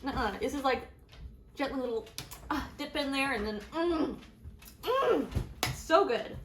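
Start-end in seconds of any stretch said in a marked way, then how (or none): 3.7-6.4 rising mm of pleasure; delighted moan. Real, not acted. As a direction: melt into the taste. Savouring the chocolate.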